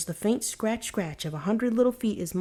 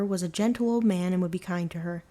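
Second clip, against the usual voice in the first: ordinary delivery, nothing marked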